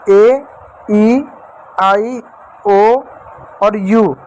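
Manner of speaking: each vowel ए, ई, आई, ओ, यू said alone with a pause after it; listing vowels. Shown as a demonstration. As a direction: count the vowels out one by one for the class. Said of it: "तो पाँच". (स्वरों को रुक-रुक कर गिनाना)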